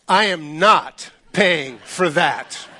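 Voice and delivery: very slow, word-by-word indignation; mock outraged refusal. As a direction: space out every word as an appalled father. Outraged father refuses to pay.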